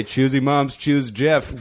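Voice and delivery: drawn-out choosy moms line; milk the pun slowly, rising on Jeff; 1 slow punchline delivery. Retold or imitated. Comic telling wife at his show, slow teasing pun. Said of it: "And I was like".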